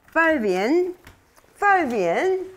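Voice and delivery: high, loud call to the animal; sing-song calling a pet's name. Real, not acted. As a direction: call him over, rising.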